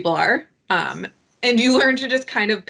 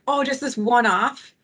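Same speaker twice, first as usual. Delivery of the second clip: higher, slow, mock-innocent coaxing; sneaky ask; 4 semitones higher than usual. Mimicking a sneaky one-off request, higher and slow. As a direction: slip it in as if it were nothing. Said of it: "those sneaky".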